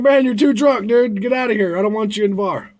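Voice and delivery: high, loud kick-out yell; bouncer yelling. Retold or imitated. Bouncer yells at a drunk to get out, high and loud. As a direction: shout him out the door.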